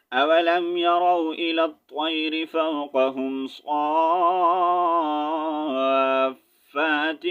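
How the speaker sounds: melodic tarteel, drawn out; Quran recitation. Acted. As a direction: chant the verse like a qari.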